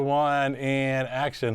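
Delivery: usual speaking voice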